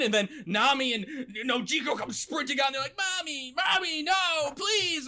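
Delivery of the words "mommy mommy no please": put-on high, wailing child's plea; hammed-up kids begging their mother; mock crying children. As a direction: cry out in a much higher voice. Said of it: "they're all crying".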